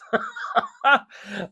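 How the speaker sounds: a quick amused laugh; bright laugh. Real, not acted. Laughing while telling students about the day. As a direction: laugh it off lightly.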